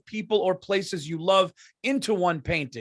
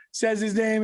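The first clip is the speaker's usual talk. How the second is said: ordinary talk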